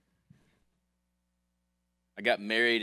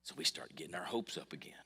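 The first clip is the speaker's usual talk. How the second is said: whispered aside; 3 semitones lower, 11 dB quieter than usual. Acted. Hushed aside about hopes.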